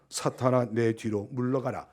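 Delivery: low, soft reading of a rebuke; measured quotation. Acted. Preacher quotes the Lord to the congregation, quietly. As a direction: keep it low and restrained.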